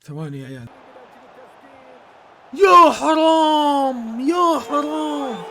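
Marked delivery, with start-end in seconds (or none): 2.5-5.4 long, stretched cry of pity; drawn-out dismay. Real, not acted. Streamer groaning over a missed chance, stretched cry. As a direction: groan it out slowly, gutted.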